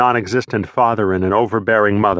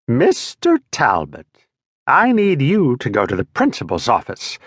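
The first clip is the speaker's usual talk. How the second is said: exasperated teacher. Acted. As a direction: stretch "Mister" like a fed-up teacher. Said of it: "one exasperated teacher".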